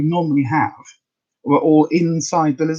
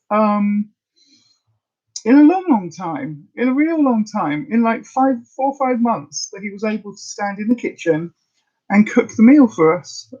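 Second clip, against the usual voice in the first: fighting back tears while praising him; getting emotional; 6 semitones higher than usual. Real, not acted. To her viewers about her partner cooking again, choked up with emotion. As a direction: voice rising and welling up, close to tears. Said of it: "I'm getting emotional".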